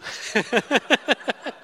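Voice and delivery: a real burst of laughter; laughing. Real, not acted. Dad laughing at his own impression of his wife. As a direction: crack up at your own silly voice.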